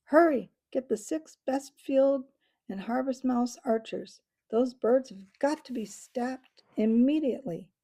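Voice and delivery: loud, urgent order; commanding shout. Acted. Matthias rallies the archers to stop the birds, loud urgent commands. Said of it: "Matthias took command".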